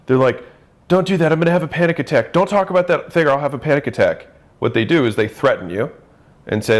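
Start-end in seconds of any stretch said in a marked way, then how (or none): 0.9-4.3 higher-pitched, rushed imitation of a panicking spouse; mock panicky threat. Spouse threatening a panic attack mid-fight, mock panicky.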